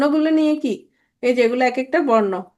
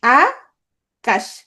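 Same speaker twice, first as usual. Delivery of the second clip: আকাশ as two separate beats, আ held, then কাশ; split into syllables; 2 semitones lower, much slower than usual. Shown as a demonstration. Teacher showing students the syllables of Akash, split slowly in two. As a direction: break Akash after a drawn-out আ. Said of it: "দুইটা অক্ষর".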